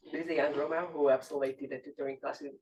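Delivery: ordinary talk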